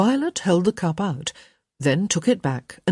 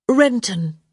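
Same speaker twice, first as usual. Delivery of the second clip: obliging; 6 semitones higher, 4 dB louder than usual. Acted. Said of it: "Hyacinth said obligingly".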